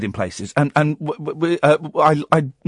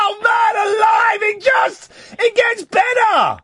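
laughing shout; slower than usual. Real, not acted. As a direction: whoop with laughter. Host delighted at caller's blunder.

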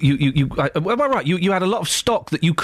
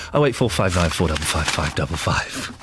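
much lower, announcer-style phone number; radio number read; 10 semitones lower than usual. Acted. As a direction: read it out like a station ident.

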